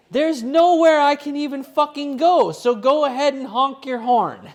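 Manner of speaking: loud angry yell, high and strained; furious yell out the car window. Retold or imitated. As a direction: shout at the driver behind, furious. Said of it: "I just start yelling out of it".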